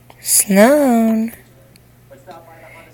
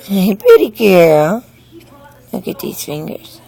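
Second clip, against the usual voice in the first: ordinary talk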